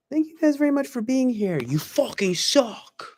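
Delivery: very high put-on voice, slowing on the insult; mock sweet sign-off. Streamer jokingly insulting viewers for viewership, high mock voice. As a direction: thank them in a high voice, then drag out the insult.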